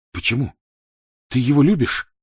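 mildly shaken, urgent questioning; faltering voice. A narrator's reading. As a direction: ask with a slight tremor, pressing for the answer. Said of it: "дрогнувшим голосом спросил он".

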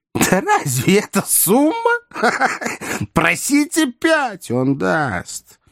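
high, put-on voice with a cackle; scoffing wheedling fixer. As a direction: scoff high, laugh, then stretch the advice. (насмешливо, высоким голосом, со смешком)

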